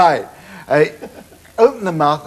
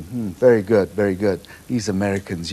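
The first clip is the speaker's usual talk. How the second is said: lightly voiced Marty, lower and grudgingly impressed; a low, offhand mock of the friend; a hint of a musician dismissing the record; imitating Marty; 8 semitones lower, 5 dB quieter than usual. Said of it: "He said".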